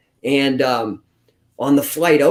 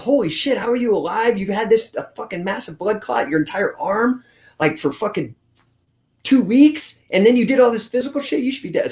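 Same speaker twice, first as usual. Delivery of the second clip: higher, alarmed disbelief; doctors freaking out; 6 semitones higher than usual. Retold or imitated. Doctors stunned he survived the clot, alarmed and higher. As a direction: play the doctors, shocked and urgent. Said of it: "they freaked out".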